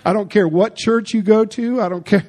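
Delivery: normal talking voice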